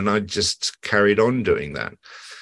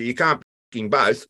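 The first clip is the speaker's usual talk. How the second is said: quoting the spark; 8 semitones higher, 3 dB louder than usual. Retold or imitated. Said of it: "He says".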